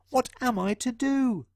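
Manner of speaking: high, small fretful mouse. Acted. John Mouse fretting over the burglar, squeaky. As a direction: ask it like a tiny worried mouse. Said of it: "he squeaked".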